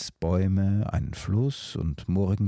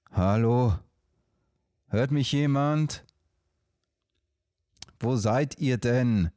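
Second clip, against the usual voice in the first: calling out; 5 semitones higher than usual. Acted. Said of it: "schrie Karl".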